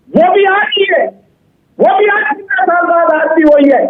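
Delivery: high-pitched, heated insistence; agitated. Real, not acted. Party spokesman challenging the anchor on inflation, agitated. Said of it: "शांत करो".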